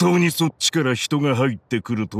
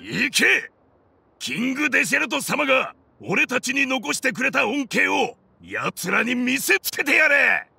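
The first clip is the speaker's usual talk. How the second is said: higher-pitched commanding call to the troops; rallying order; 8 semitones higher than usual. Acted. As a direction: urge the men forward into battle. Boss orders troops to attack.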